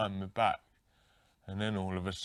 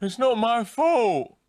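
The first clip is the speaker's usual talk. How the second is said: much higher and louder, slow; whiny outburst; 12 semitones higher, 9 dB louder, slower than usual. Pretend. [name] spoofed defending himself to the interviewer, high and loud. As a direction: protest like a scolded child.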